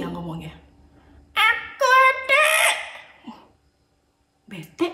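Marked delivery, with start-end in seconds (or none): 1.3-2.5 put-on high, slow, shouted kid's complaint; loud bored whine. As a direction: yell your boredom like a sulky child. Puppet shouts his complaint.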